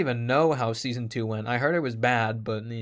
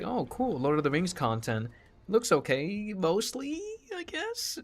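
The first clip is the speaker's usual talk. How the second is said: mock hopeful reaction, rising high and softer at the end; tentative approval; 6 semitones higher, 4 dB quieter than usual. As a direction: pitch it up into an unsure question. Acting out first reaction to show.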